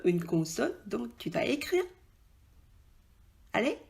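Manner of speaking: normal talking voice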